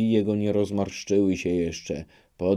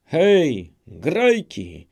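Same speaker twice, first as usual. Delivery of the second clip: higher, louder hail to the band; shouted call; 8 semitones higher, 6 dB louder, much slower than usual. Acted. Old man shouting to the musicians, loud hail. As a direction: call the musicians across the yard. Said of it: "zakrzyknął na muzykę".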